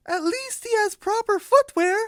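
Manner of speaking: very high, squeaky cartoon voice; squeaky character voice. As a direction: pitch way up for the punchline.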